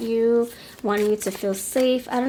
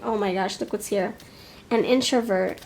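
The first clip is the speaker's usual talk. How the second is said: usual speaking voice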